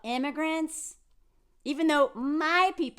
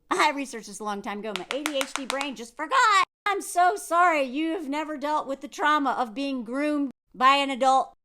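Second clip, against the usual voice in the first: ordinary talk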